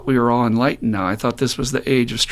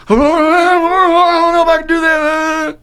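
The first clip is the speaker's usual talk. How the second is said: much higher, louder, slow whiny doubt; mock hesitant woman; 7 dB louder, much slower than usual. Mimicking a woman told to leave her man, high, slow and whiny. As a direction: drag it out like a scared excuse.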